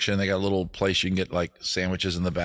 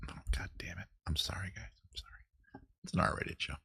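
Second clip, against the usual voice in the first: whisper; 3 semitones lower, 14 dB quieter, faster than usual. Real, not acted. Apologising for crude joke on air.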